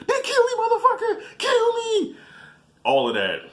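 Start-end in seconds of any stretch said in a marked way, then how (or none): 0.1-2.2 very high-pitched defiant shrieking; exaggerated hysterical yell, far above normal pitch; mock screaming. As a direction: scream it like a hammed-up fight victim. Acts out neighbor screaming in fight.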